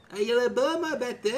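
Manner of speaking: high excited cheer; thrilled about the boat. Real, not acted. Excited over his new boat. (voix excitée et aiguë)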